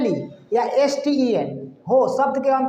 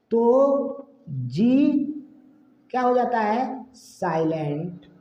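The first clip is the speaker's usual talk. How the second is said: slow dictation; much slower than usual. Shown as a demonstration. Teacher gives pupils a rule to write, slow dictation. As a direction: rule spelled out slowly in separate chunks.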